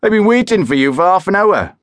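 high, loud yell out of a truck window; angry shout. Acted. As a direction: bellow it across at him, annoyed. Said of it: "he shouted".

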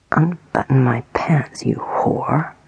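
ordinary delivery, nothing marked

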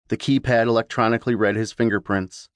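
usual speaking voice

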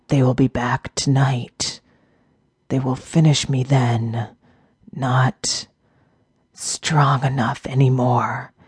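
quiet rasp fading to whisper; a wounded beast accepting death; weak dying orc. A narrator's reading.

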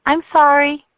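high, loud hurried sorry; young Caroline apologising to mother; anxious apology. A narrator's reading.

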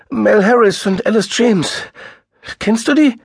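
high, loud, fast and frantic; frantic sister near tears; distraught woman. A narrator's reading. Sister asks.

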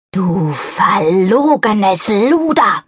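drawn-out angry cry, slow; enraged wife insulting the narrator in front of everyone; furious outburst. A narrator's reading. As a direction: spit out the insult, slowly and furious. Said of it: "schreit meine zerzauste Zuhörerin".

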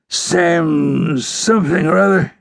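slow, high, strained question; dying outlaw. A narrator's reading. Wounded outlaw groping for the ranger's name, strained. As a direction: drag the words out weakly.

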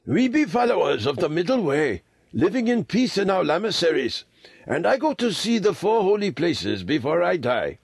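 put-on high-pitched old Tibetan lama; old lama, patient. A narrator's reading. Old lama telling boys who he is, high voice. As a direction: explain in a high old man's voice. Lama speaks of his pilgrimage.

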